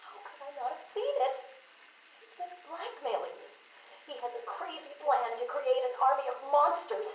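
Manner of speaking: high, agitated alarm; distressed. Acted. Doctor confessing she was blackmailed, high distressed voice.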